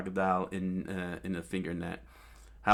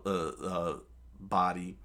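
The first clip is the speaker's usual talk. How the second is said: usual speaking voice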